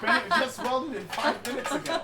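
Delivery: loud laughing, high. Real, not acted. Laughing about hot piece.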